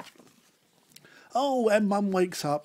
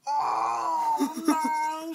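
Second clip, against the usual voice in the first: high fed-up wail; exasperated dad; 11 semitones higher, 5 dB louder than usual. Acted. Sleepless dad at his wits' end, high wailing cry. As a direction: wail it like a worn-out father. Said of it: "said Dad".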